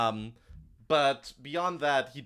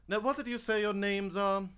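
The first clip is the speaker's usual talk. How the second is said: pitched up, falsely casual question; probing NPC; 8 semitones higher than usual. Acted. GM as the suspicious official questioning the newcomers. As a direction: ask the names like a polite trap. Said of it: "and sort of go".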